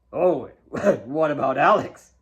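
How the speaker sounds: a hint of a father's chuckling tease; teasing laugh. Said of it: "he teased her".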